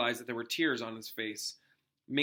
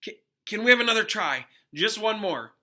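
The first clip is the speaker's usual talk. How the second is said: eager plea; 7 semitones higher, 7 dB louder than usual. A narrator's reading. Harry eager to retry.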